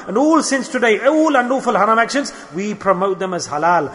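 usual speaking voice

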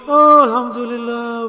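put-on plaintive complaint; mock crying reply. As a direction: imitate someone moaning while claiming to be grateful. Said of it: "We start crying in the reply".

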